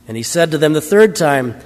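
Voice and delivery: ordinary delivery, nothing marked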